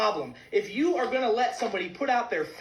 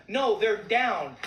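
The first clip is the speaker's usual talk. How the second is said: slow emphatic answer; much slower than usual. Real, not acted. Answers own question.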